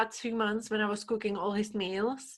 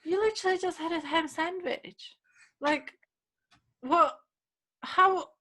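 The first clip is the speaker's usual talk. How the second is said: retold disbelief, pitched much higher; incredulous; 9 semitones higher than usual. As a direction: act baffled at the ham-eating housemate. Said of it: "And I'm like".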